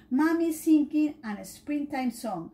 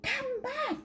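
high calling cry; Dylan calling after the feather; 13 semitones higher, 4 dB quieter than usual. Acted. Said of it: "cried Dylan".

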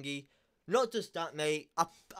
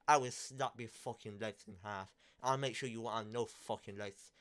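cold quiet threat; 4 semitones lower, 6 dB quieter than usual. Real, not acted. Muttered threats, voice drops low.

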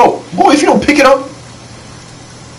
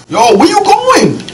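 excited; 14 semitones higher, slower than usual. Real, not acted. NPC leading him the wrong way.

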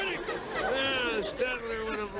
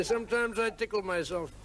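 ordinary talk, nothing marked

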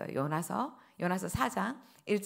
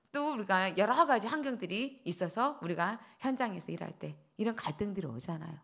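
usual speaking voice

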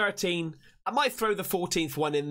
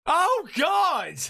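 loud, raised startled exclamation; startled cry; 9 semitones higher, 5 dB louder than usual. Real, not acted. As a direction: cry out in shock. Startled reaction to a video.

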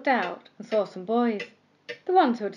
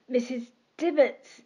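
stammered; 4 semitones higher than usual. A narrator's reading. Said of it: "he stammered".

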